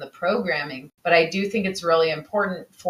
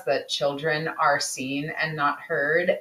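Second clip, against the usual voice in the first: ordinary delivery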